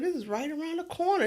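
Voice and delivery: pep yourself up in a high private voice; talking to myself. Shown as a demonstration. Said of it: "This is how me talking to myself".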